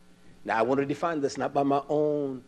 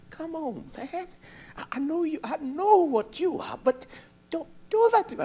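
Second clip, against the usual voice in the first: higher voice, a hurt boss begging; pleading manager; 13 semitones higher than usual. Retold or imitated. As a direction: plead like a wounded manager, pitched up.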